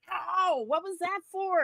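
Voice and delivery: high, hammed-up hurt yelp; mock pained protest. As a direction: imitate an indignant man just punched. Acts out Colt being punched.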